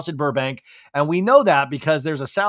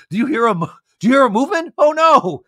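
higher and louder, hammed-up alarm; mock panic; 9 semitones higher, 7 dB louder, faster than usual. The girls hearing a sound in the house, in mock panic. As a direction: pretend to be spooked by a noise. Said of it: "the girls are like".

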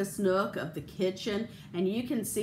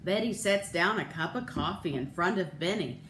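ordinary delivery

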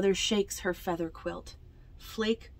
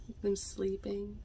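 usual speaking voice

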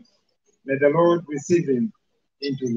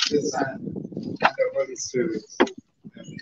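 whispered blessing; 6 dB quieter than usual. Real, not acted. Closing blessing at graveside.

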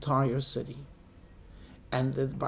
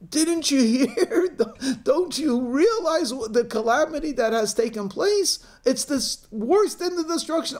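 hysterical wailing; 9 semitones higher, 3 dB louder than usual. Acted. Two crying women to the father, hammed-up hysterics. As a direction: wail at someone who hasn't heard the calamity. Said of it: "two favorite women in the world crying".

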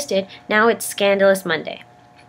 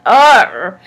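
startled yelp; 3 semitones higher, 9 dB louder than usual. Retold or imitated. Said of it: "I was just like".

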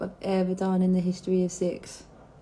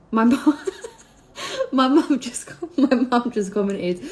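laughing through the words; 4 semitones higher, 7 dB louder, slower than usual. Real, not acted. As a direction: giggle while you say it. Laughing at mum's comment.

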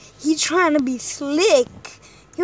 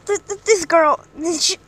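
usual speaking voice

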